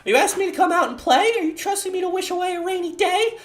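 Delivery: put-on bubbly, very high anime girl voice; cute high girl voice. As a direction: squeak it out like a cheerful schoolgirl. Voices Sayori's poem.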